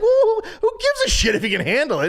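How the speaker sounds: fast, high, raging mock rant; retold outburst. Retold angry rant at dad.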